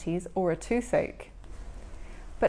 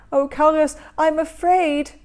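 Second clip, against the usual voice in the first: higher, louder frightened wail; frightened cry; 5 semitones higher, 9 dB louder than usual. Acted. Troll to his brother, scared of the buzzing drill. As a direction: cry out in fear to Karius.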